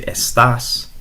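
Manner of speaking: "estás" with the final syllable stressed and rising; accented estás. Shown as a demonstration. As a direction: lean on TAS in "estás". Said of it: "if you say it with an accent".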